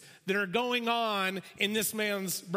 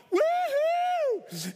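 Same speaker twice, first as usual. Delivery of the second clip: high, loud woohoo of delight; joyful whoop; 5 dB louder than usual. Acted. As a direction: let out a wild cheer. Inner joy shout.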